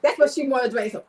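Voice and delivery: usual speaking voice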